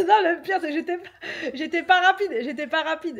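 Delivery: high, loud, tearful laughing protest; laughing through pain. Real, not acted. Hurt woman defending her fall to partner, laughing and near tears.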